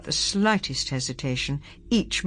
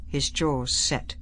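ordinary delivery

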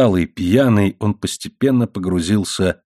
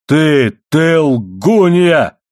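high, drawn-out bellow; enraged yell; 5 semitones higher, 5 dB louder, much slower than usual. A narrator's reading. Husband accuses his wife, bellowing. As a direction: hammer the pronoun, then stretch the accusation loud. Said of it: "заорал он".

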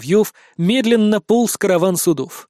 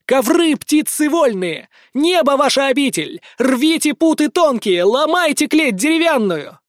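high, ringing incantation; calling out with all his might; 8 semitones higher than usual. A narrator's reading. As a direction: summon the carpets from the sky. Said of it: "изо всех сил позвал". (громкий призыв-заклинание, высоко)

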